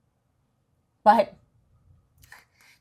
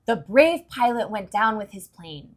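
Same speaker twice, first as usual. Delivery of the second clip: ordinary delivery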